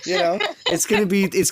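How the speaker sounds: high laugh into words. Real, not acted. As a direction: laugh through it, loud. Laughing while talking.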